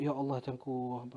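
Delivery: soft, low prayerful sigh; retold surrender. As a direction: call on God quietly, giving yourself up. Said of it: "betul-betul berserahlah". (seruan berserah, rendah dan perlahan)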